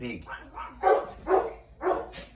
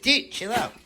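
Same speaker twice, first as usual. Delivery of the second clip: voicing the dog yelling; 8 semitones higher, 8 dB louder than usual. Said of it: "It's like".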